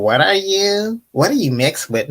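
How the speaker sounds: mock prying question, lifted in pitch; rude questioner. Rude people asking what you are, mock nosy. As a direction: imitate a blunt, nosy stranger. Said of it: "You know, the rude people".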